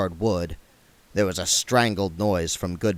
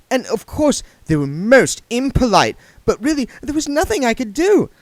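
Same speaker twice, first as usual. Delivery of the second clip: higher, louder, fast nervous babble; nervous rush; 14 semitones higher, 5 dB louder than usual. A narrator's reading. Saccharissa explains to William, nervous, fast and high. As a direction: gabble it out nervously.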